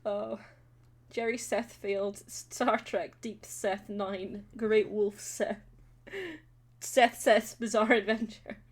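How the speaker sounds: usual speaking voice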